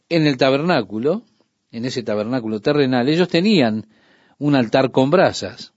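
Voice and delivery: ordinary delivery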